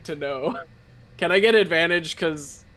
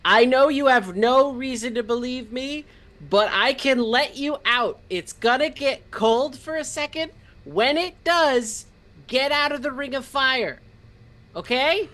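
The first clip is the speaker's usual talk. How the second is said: high, raised voice calling to someone below; yelling down a hole; 7 semitones higher than usual. Acted. Player calls to trapped cultists down the hole, yelling. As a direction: call down urgently, pitched well up. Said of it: "I'm gonna yell down the hole".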